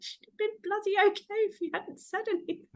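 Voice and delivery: high-pitched mock exasperation; exasperated retort. Parent answering back to mother-in-law, high mock exasperation. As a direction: retell your comeback in a high, exasperated voice. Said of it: "I was like".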